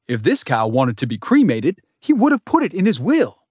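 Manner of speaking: genteel Southern gentleman, rolling voice; Southern accent. A narrator's reading. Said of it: "His voice rolls out like the aroma of smoking ribs".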